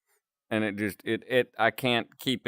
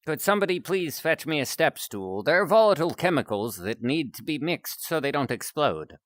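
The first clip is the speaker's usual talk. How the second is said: a hint of a prim, lilting doctor; lightly voiced fussy scientist; 3 semitones higher than usual. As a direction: play a mildly put-out scientist with swooping intonation.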